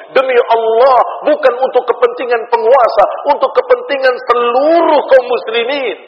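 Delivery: high, emphatic sermon rebuttal; forceful preaching. Acted. Preacher rebutting the charge of flattering rulers, forceful preaching.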